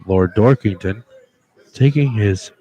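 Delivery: hushed low golf commentary; golf commentator. Pretend. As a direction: call the putt like TV golf. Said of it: "commentating a golf round".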